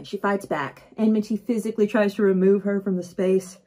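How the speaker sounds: plain reading voice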